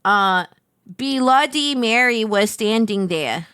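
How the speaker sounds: usual speaking voice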